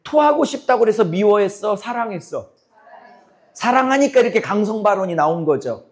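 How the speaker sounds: usual speaking voice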